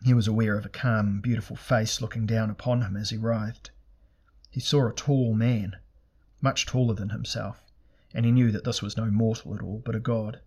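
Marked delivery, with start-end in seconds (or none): none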